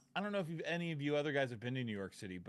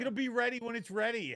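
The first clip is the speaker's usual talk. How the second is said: higher, louder comeback; loud snappy retort; 9 semitones higher, 5 dB louder than usual. Retold or imitated. Counter worker firing back at the customer, louder and higher. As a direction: snap right back at him.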